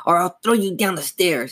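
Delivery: high, snarling puppet threat; dummy's threat. A narrator's reading. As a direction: threaten like an evil living dummy. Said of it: "the dummy repeated".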